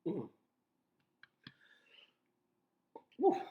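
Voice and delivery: stifled hiccup sounds; breathing out through hiccups; suppressing hiccups. Real, not acted. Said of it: "I'm sorry, I have the hiccups".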